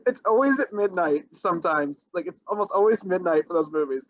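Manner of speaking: ordinary talk, nothing marked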